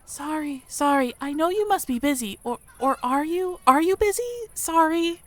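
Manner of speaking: high, timid voice tripping over apologies; a nervous mouse apologising too much; flustered shy mouse. Acted. Mia fumbling her words to the human, flustered and high.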